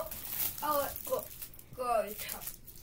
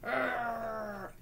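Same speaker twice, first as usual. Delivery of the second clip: growled duck grumble; mock angry duck sound; angry duck noise. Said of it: "He also does angry".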